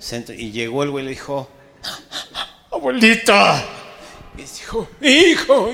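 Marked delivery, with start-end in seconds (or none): 2.7-3.6 high, loud tearful call of abuelita; grandson's cry. Acted. Grieving grandson cries out to his grandmother. 5.0-5.7 high, loud wailing hijo; old grandma cries out to her boy; grandmother's cry. Acted. Grieving grandmother calls out to her grandson.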